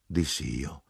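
plain reading voice

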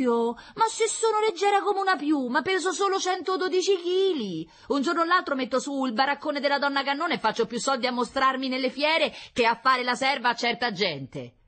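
very high, loud comic woman; brash concierge, mock-offended. Concierge brags about her weight to Meletti, mock-offended. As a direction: boast loudly in a high, put-on voice.

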